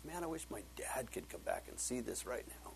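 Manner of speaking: hushed, breathy whisper; whispered wish. Retold or imitated. Son whispering his wish to see his dad, hushed. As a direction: whisper it like a private longing. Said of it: "I got this big lump in my throat".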